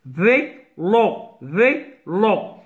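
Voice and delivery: Vélo in two detached beats, vé, lo, each time; syllable by syllable. Shown as a demonstration. Teacher repeating for pupils, Vélo cut into two syllables. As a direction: split each Vélo with a short gap. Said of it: "se prononce de deux syllabes".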